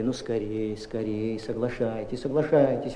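soft, hurried urging; hushed insistent coaxing; urgent pleading. Acted. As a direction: press her quietly to agree. Doctor urging the mother.

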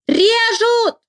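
high, drawn-out acted cry of alarm; panicked wail. A narrator's reading. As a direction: wail it out high as if under attack. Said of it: "она довольно громко завопила".

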